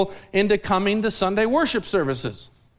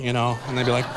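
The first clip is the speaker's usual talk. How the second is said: ordinary talk, nothing marked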